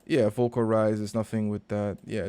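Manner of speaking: ordinary talk, nothing marked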